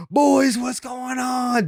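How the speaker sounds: high, drawn-out hearty greeting; Marcelo Meyer. Retold or imitated. Player greeting the podcast boys, high imitated call-out. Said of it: "He was like".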